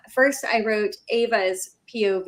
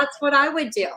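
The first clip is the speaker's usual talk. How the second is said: said through a laugh; laughing confession; 5 semitones higher than usual. Retold or imitated. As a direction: laugh while owning it. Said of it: "I was like".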